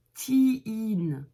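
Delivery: put-on "Teen" with a long drawn ee. Shown as a demonstration. Teacher stretches the double E of "Teen". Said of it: "Il y a deux E. On prononce les deux".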